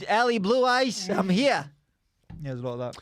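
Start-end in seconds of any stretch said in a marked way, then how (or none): 0.1-1.7 high, swaggering New York American; thick American accent. Acted. Comedian presenting himself to admirers, thick American accent. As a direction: present yourself in broad American. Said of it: "we just did our thick best American accents".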